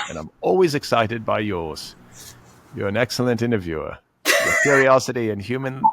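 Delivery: hammy Aussie letter read; Australian accent. Shown as a demonstration. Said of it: "It kind of started out Australian".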